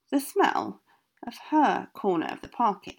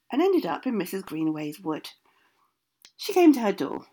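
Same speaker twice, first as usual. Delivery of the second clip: usual speaking voice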